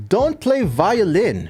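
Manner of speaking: ordinary talk, nothing marked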